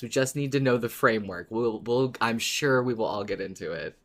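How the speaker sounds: ordinary talk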